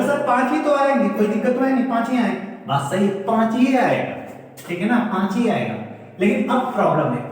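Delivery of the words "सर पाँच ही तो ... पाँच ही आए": higher, eager student voice; a pupil answering back confidently, pitched up; imitating a student. Teacher voicing a student's confident answer, higher. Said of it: "आप कहोगे".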